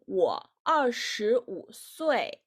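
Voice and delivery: usual speaking voice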